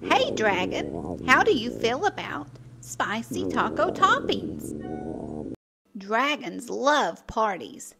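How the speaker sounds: plain reading voice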